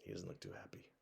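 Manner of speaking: soft whispered aside; whispering to the children about the sad boy. Acted. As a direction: lean in and whisper it to the kids. Whispered aside to young listeners.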